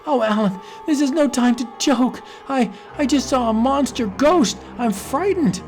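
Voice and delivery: high, urgent and scared; pleading to be believed; frightened plea. Acted. Donna telling Alan she saw a monster ghost, frightened.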